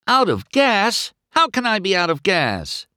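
high, loud, incredulous cry; dismayed disbelief. A narrator's reading. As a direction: wail at the sudden breakdown. McQueen stops, out of gas.

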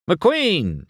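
high call, falling at the end; mild shout. A narrator's reading. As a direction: hail him like a reporter demanding attention. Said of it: "shouted a T V reporter".

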